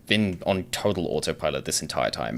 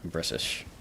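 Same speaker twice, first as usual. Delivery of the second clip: whispered aside; 8 dB quieter than usual. Real, not acted.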